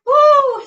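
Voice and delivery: loud high whoop; excited woo. Real, not acted. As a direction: whoop to push through. Cheering near the end.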